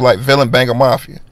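normal talking voice

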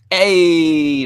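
a bright called-out hey to open; signature greeting. Shown as a demonstration. Streamer greeting the chat with his signature loud hey. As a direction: greet viewers the way we always start the stream. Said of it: "that's how we start off".

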